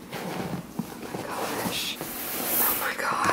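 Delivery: stunned, whispered gasp of disbelief; barely audible, awestruck whisper; overwhelmed whisper. Real, not acted. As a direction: whisper it, on the verge of tears. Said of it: "I'm gonna cry".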